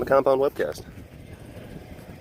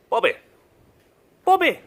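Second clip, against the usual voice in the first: high, loud call across the compound; 10 semitones higher, 4 dB louder, faster than usual. Real, not acted. Keeper calling out to a cat across the compound, loud high call. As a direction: call the cat's name from afar.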